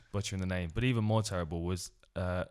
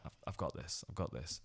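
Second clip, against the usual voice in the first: low, soft muttered reassurance; quiet self-reassurance; 6 semitones lower, 12 dB quieter than usual. Retold or imitated. Commentator psyching himself up before the name, low murmur. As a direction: talk yourself up under your breath. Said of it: "I'm like".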